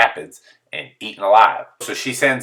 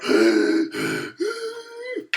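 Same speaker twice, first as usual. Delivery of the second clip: high gasping breath; big gasp for air; 6 dB quieter than usual. Retold or imitated. After swimming one pool lap, gasping for breath. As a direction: gasp like you just surfaced. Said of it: "I'm like".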